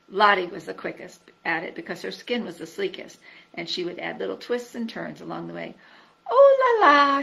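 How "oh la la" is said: high, loud whoop of joy; gleeful cry. A narrator's reading. Lottie crying out with glee as she lands in the ditch. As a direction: sing out delighted at the bottom. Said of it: "she would cry".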